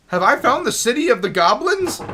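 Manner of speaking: loud, high-pitched amazed question; astonished discovery. Real, not acted. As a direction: marvel at the sudden find. Discovers a new area in game.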